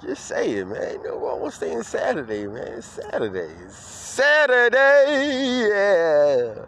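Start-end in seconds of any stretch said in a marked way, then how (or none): 3.6-6.7 very high, loud, drawn-out holler; soaring weekend cheer; exuberant shout. Real, not acted. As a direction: belt out the day, stretching every vowel. Cheers the day after plain take.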